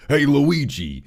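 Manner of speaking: a quick nod to old cartoon Mario in a Brooklyn accent; Brooklyn Mario impression. Reviewer quoting the Super Show Mario, lightly in a Brooklyn accent. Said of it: "Him having a Brooklyn accent".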